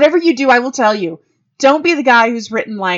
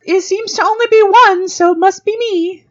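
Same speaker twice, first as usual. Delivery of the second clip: high, louder sheepish answer; sheepish reply; 11 semitones higher, 5 dB louder than usual. Retold or imitated. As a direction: own up, pitched well up. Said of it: "I was like".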